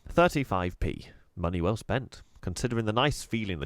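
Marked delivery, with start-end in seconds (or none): none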